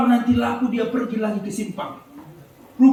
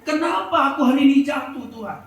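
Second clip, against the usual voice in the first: higher, louder cry of hurt to God; pained complaint to God; 6 semitones higher, 3 dB louder than usual. Retold or imitated. Mother fallen in the mud complains to God, pained. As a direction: wail why she fell, in pain. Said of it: "dia sambil ngomong kepada Tuhan".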